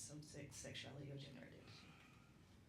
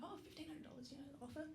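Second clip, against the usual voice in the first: softer, higher, casual aside; offhand mention; 7 semitones higher, 7 dB quieter, faster than usual. Retold or imitated. Telling mother-in-law about the offer, offhand. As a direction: slip it in softly as if trivial.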